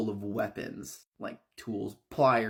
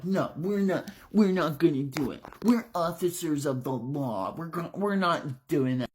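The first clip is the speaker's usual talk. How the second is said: louder, higher, indignant refusal; defiant officers; 5 semitones higher, 4 dB louder than usual. Acted. Caged officers refuse the women, loud and indignant. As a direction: dig in like cornered cops. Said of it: "the guys are like".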